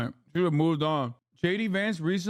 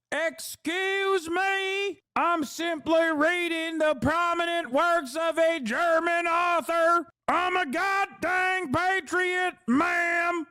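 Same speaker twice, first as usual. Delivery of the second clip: prim indignant mock; 12 semitones higher, much slower than usual. Mocking the Nazi's prim defense.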